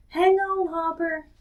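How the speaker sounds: shouted high and loud; yelled across the river; shouting. Acted. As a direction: call it after a friend swept away. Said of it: "he shouted out".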